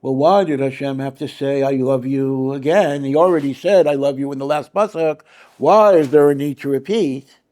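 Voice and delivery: mock a hair-splitting Talmud student picking at repetition; analytical Brisker. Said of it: "like you're an analytical Brisker going through a Gemara".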